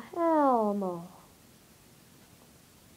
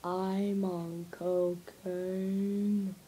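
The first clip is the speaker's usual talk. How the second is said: drug dealer voice; 5 semitones lower, 7 dB quieter, much slower than usual. Acted.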